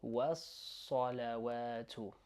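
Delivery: was-salawatu slow, with a long held S; doubled S in was-salawatu. Shown as a demonstration. Teacher showing two written letters, long S in was-salawatu. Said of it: "emphasizing it a bit more".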